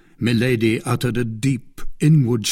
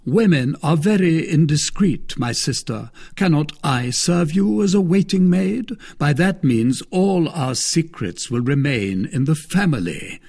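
higher, mocking lord; a smug, teasing brother-in-law; Lord de Winter; 6 semitones higher, 3 dB louder than usual. Lord de Winter toying with Milady, higher voice.

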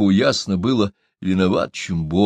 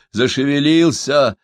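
ordinary delivery

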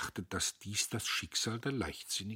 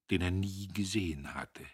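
usual speaking voice

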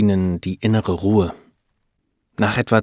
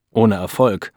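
ordinary delivery

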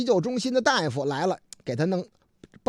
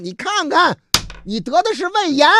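high, loud insistence; exasperated policeman; 10 semitones higher, 7 dB louder than usual. Acted. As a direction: set the silly patient straight, loudly. 警察不耐烦地告诉病人. (不耐烦地高声点破)

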